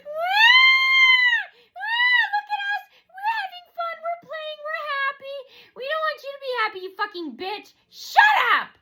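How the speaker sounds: very high wailing shriek; mock screaming. Imitating Dave interrupting appointment talk, screaming. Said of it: "Dave would also scream".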